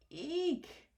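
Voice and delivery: high frightened eek; shriek. A narrator's reading. Elephant sees the mouse, a high frightened eek. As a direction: squeal in a fright. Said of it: "shouted the elephant".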